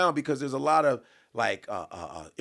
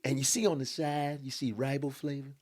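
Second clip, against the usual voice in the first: imitating Tony Baker; 4 semitones higher than usual. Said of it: "Tony Baker be like".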